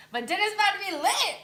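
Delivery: hyped. Real, not acted. Hyping dinner.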